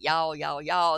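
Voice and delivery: a playful Texas drawl on y'all; mock Texan twang. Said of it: "So sometimes I'll be like".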